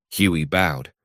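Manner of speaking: ordinary delivery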